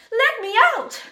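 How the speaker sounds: loud, high trapped yell; bellowed demand. A narrator's reading. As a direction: bellow to be freed. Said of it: "She bellowed".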